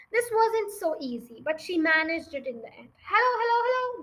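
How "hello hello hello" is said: high, quick parrot chant; chattering parrot. Acted. Parrot calling down the chimney to Matilda, high chant. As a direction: call down in a high bird voice. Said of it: "the bear talked down to her".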